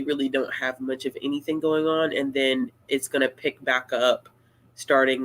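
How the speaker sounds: usual speaking voice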